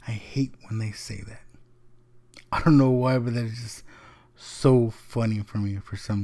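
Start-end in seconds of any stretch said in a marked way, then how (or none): 0.1-1.4 quiet, held-back complaint; restrained complaint. Retold or imitated. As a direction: say it quietly, keeping the annoyance in. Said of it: "but he just said".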